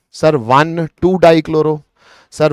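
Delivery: usual speaking voice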